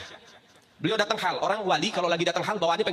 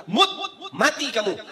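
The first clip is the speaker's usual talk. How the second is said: higher, slow and stern; sudden curse; 7 semitones higher, 3 dB louder, slower than usual. Acted. Startled saint curses the child who burst in, high and slow. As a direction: bark it out, startled.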